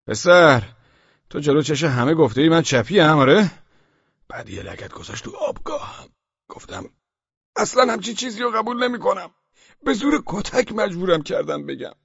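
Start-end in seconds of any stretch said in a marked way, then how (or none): 0.1-3.5 loud accusing shout; shouting policeman. Acted. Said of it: "داد زد". 7.6-11.9 much higher desperate denial; desperate protest. Acted. Protesting innocence to police.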